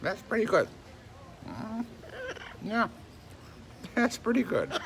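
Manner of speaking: high cooing pet talk. Real, not acted.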